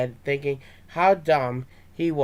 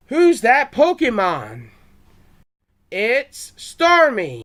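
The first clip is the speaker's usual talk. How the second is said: higher, louder, slow quiz host voice; show announcer; 8 semitones higher, 7 dB louder, slower than usual. Retold or imitated. Storyteller doing the cartoon's quiz segment, announcer voice.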